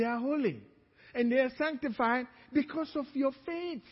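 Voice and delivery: emphatic pulpit call and answer; preaching. Acted. Pastor insisting to the congregation their children are holy, preaching. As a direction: drive the point home to the church.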